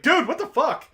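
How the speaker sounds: shocked yell. Acted. Big prison dude recoils from the little guy, yelling in shock. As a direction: shout it in disbelief.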